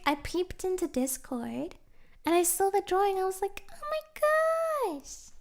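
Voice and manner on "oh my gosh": high, slow gasp of delight; drawn-out squeal of wonder; gushing awe. Retold or imitated. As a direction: gush over the drawing in awe. Gushing over a fan drawing.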